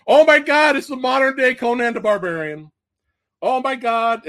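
high, loud excited gush; imitating his own hyped reaction; excited outburst. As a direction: burst out like a thrilled fan. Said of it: "because I was like".

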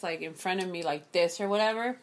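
ordinary delivery

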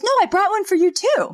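high, eager mock offer; eager Jeffrey.

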